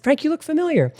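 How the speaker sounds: retold wonder at a familiar face; curious. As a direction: sound like a face is clicking. Retold meeting, recognition dawning.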